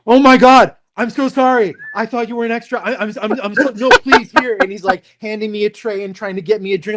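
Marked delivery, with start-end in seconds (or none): 0.1-4.6 high, flustered apologizing PA; imitating a panicked crew member; panicked apology. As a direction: babble sorry like you might get fired. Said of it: "And the guy goes".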